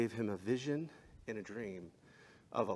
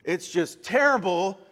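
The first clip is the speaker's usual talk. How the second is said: mock dismay; 9 semitones higher, 13 dB louder than usual. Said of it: "we're like".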